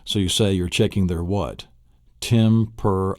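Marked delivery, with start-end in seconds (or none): 2.2-3.2 "temperature" broken up as "tem-per-a-ture"; four syllables. Shown as a demonstration. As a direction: pause after each syllable of "temperature". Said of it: "It's actually four syllables".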